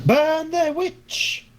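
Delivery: high, slow mob shout; rally the mob to burn her; angry mob leader. Acted. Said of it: "I just thought of Monty Python".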